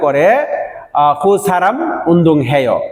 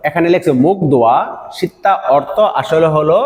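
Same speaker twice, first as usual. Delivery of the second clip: ordinary talk, nothing marked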